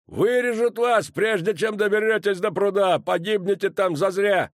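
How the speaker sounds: dwarf shouts warning at commander; gruff dwarf warning. A narrator's reading. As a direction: bellow the danger at her. Dwarf warns against charge.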